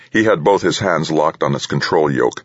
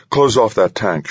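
higher, firm cockpit command; urgent order; 7 semitones higher than usual. A narrator's reading. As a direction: give a brisk emergency order. Pilot gives emergency order to copilot.

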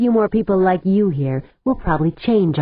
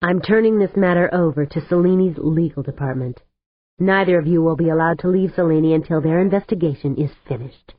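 ordinary delivery, nothing marked

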